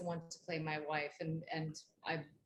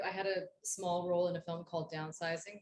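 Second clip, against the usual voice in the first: ordinary talk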